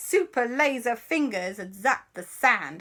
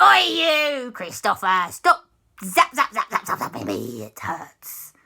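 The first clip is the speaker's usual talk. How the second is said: pained pirate ghost; 5 dB louder, slower than usual. Acted. Pirate ghost scolds Christopher for zapping, indignant. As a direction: burst out, then sag into complaint.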